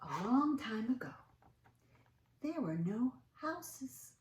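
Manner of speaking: put-on slow, drawn-out reading to children; storybook reading. A narrator's reading. Reading the picture book aloud to children, expressive. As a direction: read it like story time, savoring words.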